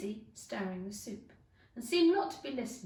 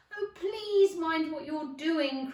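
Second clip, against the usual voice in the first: slow, loud pleading cry; frightened cry; 5 semitones higher, 7 dB louder, slower than usual. Acted. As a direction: beg them to stop, terrified. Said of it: "cried Alice".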